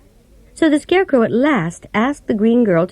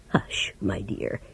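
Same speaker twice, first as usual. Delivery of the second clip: hushed whisper, much lower and softer; 11 semitones lower, 13 dB quieter, slower than usual. A narrator's reading. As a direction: hush her so no one overhears. Wizard hushing, afraid of exposure.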